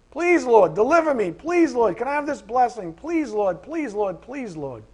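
high, loud begging that fades to a mutter; mock needy prayer, loud then fading; whiny pleading. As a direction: beg God over and over, trailing off. Mocking a self-serving prayer.

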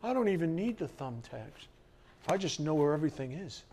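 a light mock boast from a know-it-all; smug and quick, like a know-it-all; mock show-off. Preacher mimicking a show-off without thumbtacks. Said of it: "You don't have to be a show-off".

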